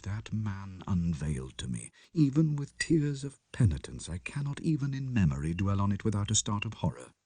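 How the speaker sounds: soft, low and shaken confession; hushed, haunted narration. A narrator's reading. Lanyon writing his last letter to Utterson, hushed. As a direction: read it like a dying man's last words.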